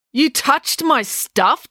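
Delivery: high, slow, appalled question; outraged demand. A narrator's reading. April finding out Loretta moved her things, outraged demand. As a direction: punch each word in rising outrage. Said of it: "demanded April".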